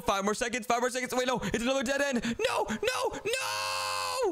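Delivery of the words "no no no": high scared shout; frightened yelp, much higher; panicked cry. Real, not acted. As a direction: cry out as you're caught. Trapped in dead end.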